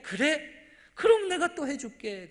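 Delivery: loud, high, willing agreement; ready consent. Acted. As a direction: agree emphatically, with no reproach. God readily granting Gideon's second request. (크고 높은 소리로 흔쾌히)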